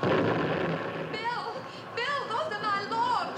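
high, drawn-out wail of alarm; high-pitched, slow cry of dismay; distressed cry. Acted. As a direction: call out desperately from a distance. Calls out as logs are blown.

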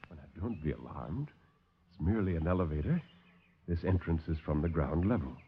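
low, soft, smooth villain; calm the visitors like a silky host; suave doctor. Acted. Doctor soothes the alarmed officers, low and soft.

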